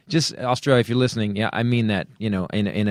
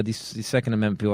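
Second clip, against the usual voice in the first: usual speaking voice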